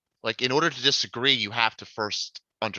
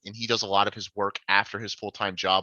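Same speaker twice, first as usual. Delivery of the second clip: normal talking voice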